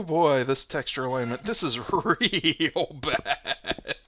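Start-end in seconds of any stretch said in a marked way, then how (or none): 1.4-3.9 laughing. Real, not acted. Laughing at texture.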